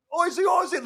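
high mock Aussie chant; 澳洲人起哄口号. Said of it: "they were like".

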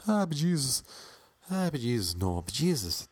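hammed-up stage Irish, starting high and sliding down; Tom Cruise's Irish accent. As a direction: try out a bad Irish accent. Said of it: "We want that kind of Irish accent".

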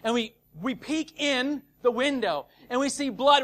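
ordinary talk, nothing marked

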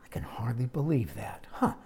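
hushed, sly mock disbelief; whispering serpent. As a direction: whisper it like a scheming snake.

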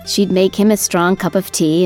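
plain reading voice

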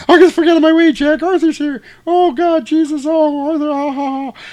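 put-on very high, loud girly falsetto squeal; mock swooning girl. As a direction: ham up a giddy girl spotting her crush. Lucy thrilled Arthur arrives.

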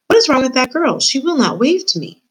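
high, petulant little royal; indignant princess. A narrator's reading. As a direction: complain like an affronted princess. Indignant princess complains to the king.

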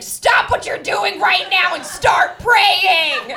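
loud, slow commanding shout; booming voice. Retold or imitated. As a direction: thunder like a divine voice. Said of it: "this voice that was just like".

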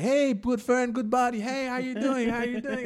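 high, overly chummy voice; high sing-song buddy voice; fake-friendly greeting. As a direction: greet like a phony pal. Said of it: "he was just like".

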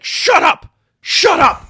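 high, loud yell at a crowd; full-voiced, dismissive stage shout; angry shout. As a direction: bellow it to silence the applause. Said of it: "dismissive and angry with the crowd".

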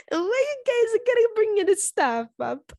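high, slow whining complaint; whiny mock protest. Real, not acted. Repeats protest, drawn out.

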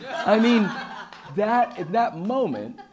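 can't stop laughing while talking. Real, not acted. Telling the crowd about his brother, laughing.

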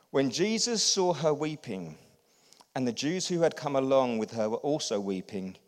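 normal talking voice

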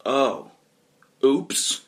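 loud, dopey little exclamation; sheepish sudden oops; blurted realization. Acted. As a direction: blurt it out, word by word. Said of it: "until I just blurt out".

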